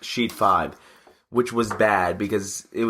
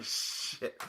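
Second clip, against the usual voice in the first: whispered curse, much softer; whispered dread; 15 dB quieter than usual. Retold or imitated. On hearing the work has errors, whispered dread. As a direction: swear under your breath, retelling the moment.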